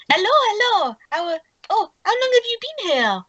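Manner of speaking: put-on high-pitched French accent. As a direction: greet them in a lilting, high French accent. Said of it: "his French accent".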